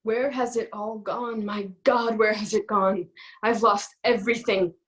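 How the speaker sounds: lines run together at normal level; no pauses between lines. Acted. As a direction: keep it moving straight through, no gaps. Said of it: "Do not pause in between any one line".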